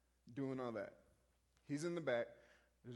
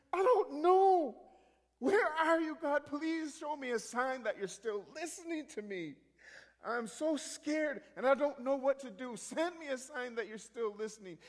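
high, loud desperate plea to God; distraught praying; 10 semitones higher, 9 dB louder than usual. Retold or imitated. Keisha alone on the porch praying to the Lord, distraught. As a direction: cry out in anguish, easing into begging.

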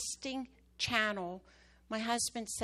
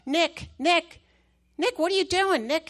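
calling out urgently; 7 semitones higher, 11 dB louder than usual. Retold or imitated. Caller shouting for Nick on phone.

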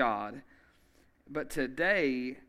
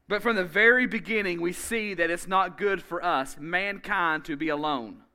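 louder, higher preacher's build; preaching at full force; 4 semitones higher, 5 dB louder than usual. Acted. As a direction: drive the point home to the congregation.

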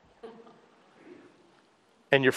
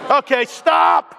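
raised, drawn-out command; calling a halt; 4 semitones higher, 4 dB louder, slower than usual. Real, not acted. Preacher halts the congregation's chant, loud drawn-out call. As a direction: cut across the crowd, higher and stretched.

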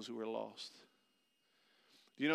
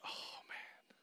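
relieved whispered sigh; 12 dB quieter than usual. Retold or imitated. Relief after finding lost daughter.

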